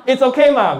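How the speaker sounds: "It's okay ma" high and loud, "ma" falling firmly; dismissive ma. Shown as a demonstration. Teacher shows students the obvious-answer ma, emphatic. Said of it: "It's so okay, you shouldn't even be asking".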